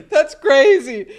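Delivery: loud, slow, high laughing exclamation; laughing disbelief. Real, not acted. Streamer amazed by the family gossip, laughing disbelief. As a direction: laugh it out, incredulous.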